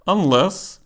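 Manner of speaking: ordinary talk, nothing marked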